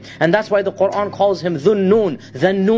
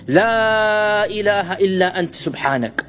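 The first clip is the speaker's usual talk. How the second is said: intoned prayer. Acted.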